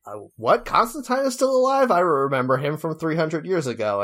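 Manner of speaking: high, incredulous retold reaction; mock surprise. Host retells character hearing Constantine is alive, mock surprise. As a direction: play astonishment, voice jumping high then easing down.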